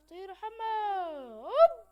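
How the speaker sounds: fly off with a loud whoop; excited shout. Real, not acted. Gamer leaping away from the seeker, whooping shout. Player fleeing the seeker in game.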